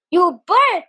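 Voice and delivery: loud, high jeer; yelled. A narrator's reading. Jules yelling an insult from a distance, loud and high. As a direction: hurl it like an insult from afar. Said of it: "Jules yelled in the distance".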